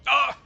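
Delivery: loud, high startled shriek; startled yelp. Real, not acted. Caught off guard by the game, a loud high yelp. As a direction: jump at the scare.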